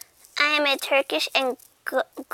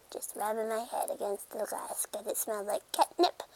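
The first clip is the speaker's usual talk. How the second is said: whispering; 3 semitones lower, 9 dB quieter than usual. Pretend. Cat rubbing on glass.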